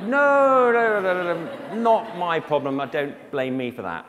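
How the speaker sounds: loud, high mock panic, easing as it goes; alarmed protest. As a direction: cry out in comic horror, then settle. Acted alarmed reply to caller.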